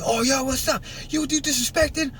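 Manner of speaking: a lightly voiced high challenge from a gang kid; squaring up to him; hint of a street tough; confronting thug. Rival gang member confronting him.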